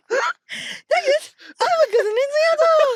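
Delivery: loud, very high British squeal; hammed-up British accent imitation; mimicked British accent. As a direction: echo the British girl's voice, piercingly high. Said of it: "So don't mock the accent".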